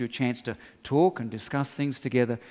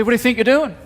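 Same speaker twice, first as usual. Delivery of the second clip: raised, higher, louder demand; wife catching him out, higher and louder; wife's angry challenge; 8 semitones higher, 11 dB louder, faster than usual. Retold or imitated. Wife confronting him, retold.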